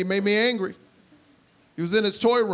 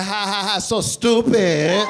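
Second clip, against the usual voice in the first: dismissive mom; 5 dB louder, slower than usual. Retold or imitated. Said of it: "My mom's like".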